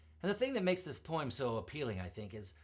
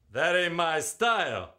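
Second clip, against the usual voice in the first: cocky Casey; 6 semitones higher, 10 dB louder than usual. Acted. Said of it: "said Casey".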